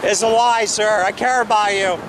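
ordinary delivery

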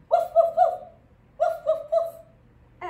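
loud, high yappy woofs; short high bursts of barking; dog bark. Shown as a demonstration. Instructor answering what Oscar says, loud high woofs. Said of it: "What does Oscar say?".

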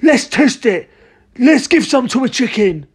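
high, eager character voice; excited shouting. A narrator's reading. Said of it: "shouted Mister Cranky, hopping about".

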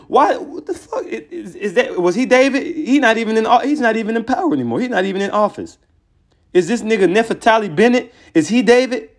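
high-pitched, half-laughing disbelief; incredulous. Real, not acted. Incredulous rant at rival's leader.

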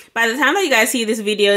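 ordinary talk, nothing marked

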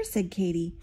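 usual speaking voice

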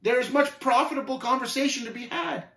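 high, projected plea; calling after. Acted. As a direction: call out to someone retreating fast. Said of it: "Faithful called after fast retreating form".

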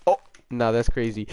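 normal talking voice

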